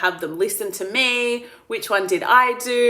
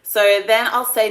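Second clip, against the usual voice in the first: usual speaking voice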